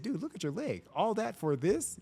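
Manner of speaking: high-pitched retold disbelief; incredulous chiding. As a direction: scold a friend for a pointless mistake. Officer chiding injured suspect.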